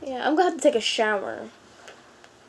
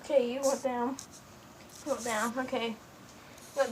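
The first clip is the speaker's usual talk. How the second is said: soft voice to pet; 14 dB quieter, faster than usual. Real, not acted. Talking softly to her cat.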